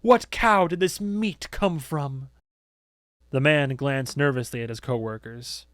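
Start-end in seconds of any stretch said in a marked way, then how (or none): 0.0-2.3 high, slow, projected demand; theatrical prosecutor's question; accusing interrogation. A narrator's reading. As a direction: grill the worker in front of everyone. Accuser interrogates a worker aloud.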